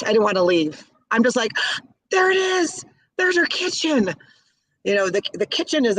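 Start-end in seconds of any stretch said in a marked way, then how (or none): 1.8-4.1 gasp, then high, thrilled discovery; excited gasp. Retold or imitated. Fan recalls finding Julia Child's kitchen, high and thrilled. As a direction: squeal it like spotting a treasure.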